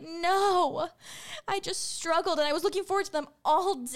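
put-on high, loud, weepy kid voice; distraught student. Teacher voicing a student who could not open her chips. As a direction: sob it out like a crushed middle schooler. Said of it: "she was like".

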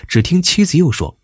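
usual speaking voice

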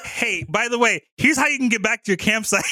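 high, chirpy offer; over-helpful sudden friendliness; mock-friendly helpfulness. Beasts suddenly friendly, giving directions.

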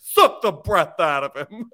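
loud, high director's command, breaking into laughter; mock porn director. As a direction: bellow it as an absurd director. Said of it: "it's like a porn director".

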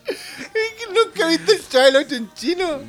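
high, teary laughing voice; crying with laughter. Real, not acted. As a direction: speak through tears of laughter. Laughing so hard it sounds teary. (hablando entre lágrimas de risa)

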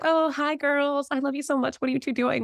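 high, sugary greeting; gushing aunt. Retold or imitated. Aunt greets her two nieces in the mall, gushing. Imitates sweet aunt's greeting.

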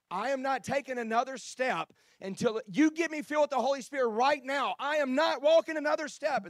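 acted high-pitched, very loud rant; exasperated shouting. Weary seeker refusing another step to the preacher, shouting exasperatedly. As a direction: shout it as a fed-up ultimatum. Fed-up walker's outburst in story.

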